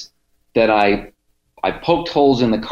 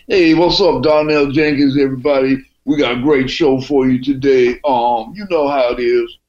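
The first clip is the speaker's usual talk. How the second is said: higher, swaggering radio-host dude from Cleveland; 4 semitones higher than usual. Retold or imitated. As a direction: put on the cocky Cleveland guy's show intro. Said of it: "And I just love that voice".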